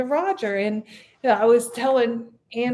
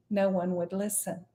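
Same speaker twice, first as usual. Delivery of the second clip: ordinary delivery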